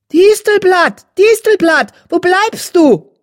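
high, loud, impatient call, slowing at the end; irritated tomcat calling out; annoyed calling. Acted. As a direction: call her name across the den, fed up. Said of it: "der ärgerlich vor dem Bau miaute".

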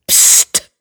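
unvoiced warning "psst"; urgent shush. A narrator's reading. Panicked companion silences Tigerstern, urgent hiss. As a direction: hush them sharply without voice.

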